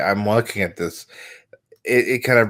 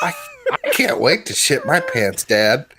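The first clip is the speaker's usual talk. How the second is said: put-on little kid voice; 6 semitones higher than usual. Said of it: "this little kid".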